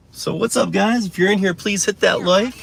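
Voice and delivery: vlogger greeting. Acted. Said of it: "greeting viewers of the stream".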